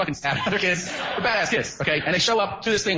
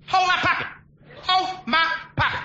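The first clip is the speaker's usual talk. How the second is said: squeaky convict yell; 11 semitones higher than usual. Retold or imitated. Inmate yelling at kids to hold his pocket, high mock shout. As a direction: bark it up high like a wild inmate. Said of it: "he goes".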